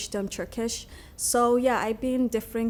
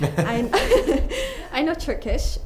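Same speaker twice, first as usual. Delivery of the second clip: laughing through the answer, a bit louder; 6 semitones higher, 7 dB louder, slower than usual. Real, not acted. Student answering a board member's question, laughing. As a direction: giggle as you list the first language.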